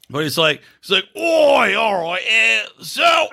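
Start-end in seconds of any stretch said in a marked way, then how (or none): 1.2-3.3 very high, drawn-out hammed-up British bloke; British accent turned Aussie. Retelling a dad's warning about his daughter, hammed-up British accent. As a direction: squawk like a caricature British dad, drifting Aussie. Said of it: "my, my British accent, I'm turned Aussie".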